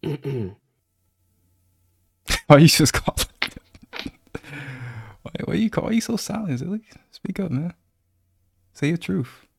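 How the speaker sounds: high-pitched teasing prod; mock goading. Teasingly goading silent friend to speak.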